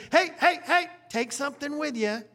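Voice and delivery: put-on high, loud mother calling her boy back; mom calling after son. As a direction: call after the kid before he runs off.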